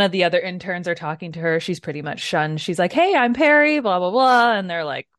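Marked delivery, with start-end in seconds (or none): 2.9-4.5 bright, louder, higher chirpy hello; imitating an eager new intern saying hi; chirpy mock greeting. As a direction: play it overeager and cheerful, pitched up. Perry greeting the other interns.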